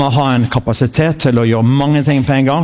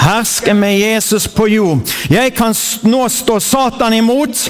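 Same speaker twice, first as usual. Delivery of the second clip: slow, proclaiming call for the congregation; preacher leading a spoken creed; leading a confession; 7 semitones higher, slower than usual. Acted. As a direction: declare it for them to echo. Leads confession to repeat.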